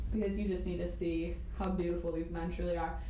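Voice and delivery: ordinary delivery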